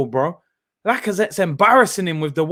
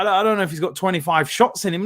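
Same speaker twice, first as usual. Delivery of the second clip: normal talking voice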